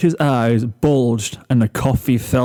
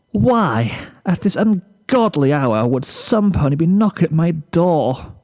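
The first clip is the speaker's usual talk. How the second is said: higher, theatrical lament of a sleepy diva; dramatic Rarity; 4 semitones higher than usual. Acted. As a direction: beg the heavens to be left asleep. Rarity dreads early knocking.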